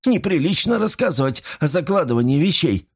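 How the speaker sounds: high-pitched, jittery remark; nervous. A narrator's reading. Pawnbroker excuses secrecy about pawning, nervous. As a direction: keep it light but uneasy. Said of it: "нервно хихикнул он".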